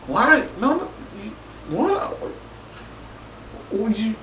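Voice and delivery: high, hushed stammering; retold bafflement. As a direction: mimic being lost for words, soft and halting. Retold baffled response to hug request.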